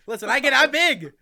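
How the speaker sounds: high, loud mock-outraged yell; shouted comeback. Streamer clapping back at someone calling them fat, shouted comeback. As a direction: holler the retort back at the troll.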